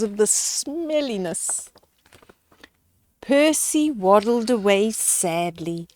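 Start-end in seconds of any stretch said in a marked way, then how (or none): none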